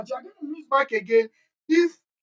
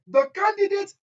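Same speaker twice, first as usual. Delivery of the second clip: high, formal examiner's phrasing; teacher mimicking the exam board's wording; quoting the examiner; 7 semitones higher, 6 dB louder than usual. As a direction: read it out like the marking scheme. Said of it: "waec will say".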